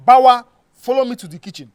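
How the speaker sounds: angry, no-argument instruction; stern command. Acted. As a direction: summon him forcefully. Stern warning in quarrel.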